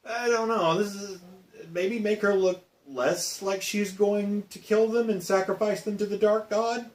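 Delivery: lightly voiced unsure executive; mock test audience. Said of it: "were like".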